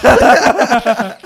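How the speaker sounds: high, loud, laughing hey; loud mock hey. Teasing the friend about to tell a story, loud laughing hey. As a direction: bellow it to tease him. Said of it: "mocking the storyteller's hey".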